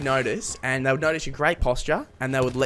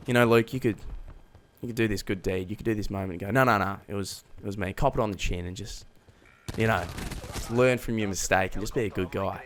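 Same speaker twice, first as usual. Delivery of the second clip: inner conscience; 6 semitones lower, 7 dB quieter than usual. Retold or imitated.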